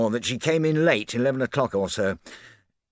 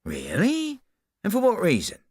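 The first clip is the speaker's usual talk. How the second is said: high, sharp surprised query; a hint of startled disbelief; surprised question; 13 semitones higher than usual. A narrator's reading. Japp hears Poirot asked for the meeting, surprised.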